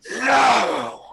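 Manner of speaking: loud, high snarled cry; wild, drawn-out snarling shout. Acted. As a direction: snarl it out with full force. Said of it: "I just snarl".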